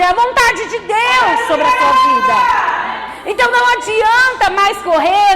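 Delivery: loud, high, fervent preaching; preaching at full force. Acted. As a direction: drive it home to the church. (pregação fervorosa e alta)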